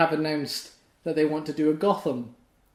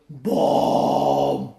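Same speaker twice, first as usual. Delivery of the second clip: Bane voice; 4 semitones lower, 3 dB louder than usual. Retold or imitated. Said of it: "all you hear is a going".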